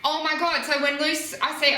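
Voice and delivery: normal talking voice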